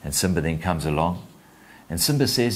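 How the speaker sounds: ordinary talk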